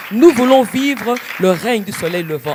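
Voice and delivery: starts at talking level, swells to loud and high; building proclamation. Real, not acted. Guest proclaiming God's light over the nation, building to a loud climax. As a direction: build each repeated phrase towards a ringing declaration.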